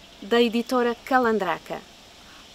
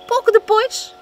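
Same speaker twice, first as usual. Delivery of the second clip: ordinary delivery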